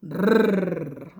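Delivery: a long trilled "rrr"; teacher models a long trilled "rrr"; rolled R sound. Shown as a demonstration. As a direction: roll the R slowly for the kids. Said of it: "el sonido de la doble R".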